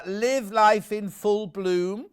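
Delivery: slow, gentle reading of a saying; soothing verse reading. Shown as a demonstration. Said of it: "Love hearing you read those verses out, very soothing".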